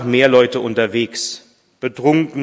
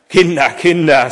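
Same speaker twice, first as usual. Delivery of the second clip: loud, higher exclamation of dismay; head-shaking dismay; 6 semitones higher, 7 dB louder than usual. A narrator's reading. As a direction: tut over them in a raised voice. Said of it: "sagte Vater Martin kopfschüttelnd".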